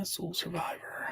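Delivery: barely voiced whisper to himself; soft whisper while reading chat; whispered name. Real, not acted. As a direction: mouth the name as he searches.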